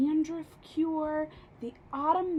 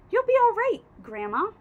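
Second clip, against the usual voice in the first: put-on reassuring boy; 7 semitones higher, 6 dB louder than usual. A narrator's reading.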